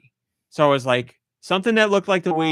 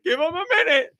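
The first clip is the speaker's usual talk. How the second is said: high, pleading voice; 12 semitones higher than usual. Retold or imitated. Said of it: "I wanted to be like".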